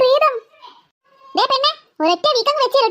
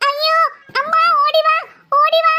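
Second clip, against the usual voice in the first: screaming for mum; 4 semitones higher than usual. Acted. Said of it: "നീ വിളിച്ചു കൂവിയിട്ടൊന്നും കാര്യമില്ലടി".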